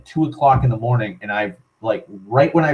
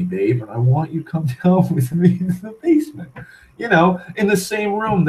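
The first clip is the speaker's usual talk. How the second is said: whiny, higher-pitched begging; drunk pleading; 4 semitones higher than usual. Retold or imitated. Lonely drunk Mark begging his girlfriend to join him, pleading. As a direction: imitate a needy drunk boyfriend wheedling.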